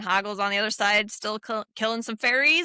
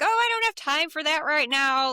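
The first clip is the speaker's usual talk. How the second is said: hurried refusal; 6 semitones higher, 3 dB louder than usual. Retold or imitated. Heroine brushing off worm's invitation.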